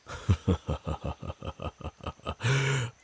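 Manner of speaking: chuckle, pleased with yourself; a sly predator's laugh; teasing chuckle. Acted. Werewolf flirting with elf.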